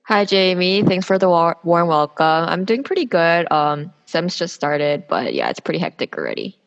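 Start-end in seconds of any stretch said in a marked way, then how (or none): none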